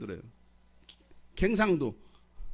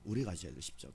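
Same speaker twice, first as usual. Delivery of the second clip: much softer, low repeat of the line; soft echo; 11 semitones lower, 9 dB quieter than usual. Acted. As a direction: let it sink in quietly. Preacher repeats line softly. (낮고 조용하게 되뇌듯)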